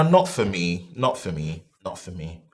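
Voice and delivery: fading repeat. Real, not acted. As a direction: let it fade to nothing.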